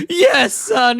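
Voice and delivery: high mock-tearful wail; Homer. Dad confirms the bad news to his son, mock crying. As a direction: imitate a dad bursting into tears. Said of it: "He starts crying".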